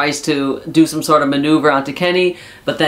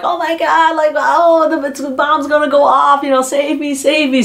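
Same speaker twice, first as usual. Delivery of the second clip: high-pitched mock panic; whiny pretend plea to be saved; 10 semitones higher than usual. Wrestlers mocking their rival over the bomb, mocking. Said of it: "they started mocking, uh, Eddie".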